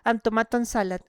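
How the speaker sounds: ordinary delivery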